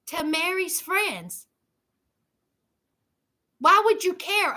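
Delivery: usual speaking voice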